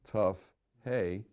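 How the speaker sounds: ordinary talk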